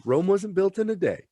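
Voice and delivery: high, softly put-on voice; Tilly making excuses. Tilly excusing her slip into killing, high-voiced self-justification. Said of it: "and she's like".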